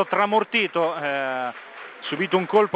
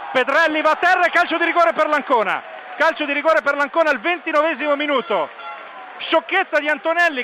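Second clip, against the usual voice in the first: excited commentary; 6 semitones higher than usual. Real, not acted. Penalty call in live commentary.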